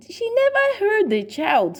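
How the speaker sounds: ordinary delivery, nothing marked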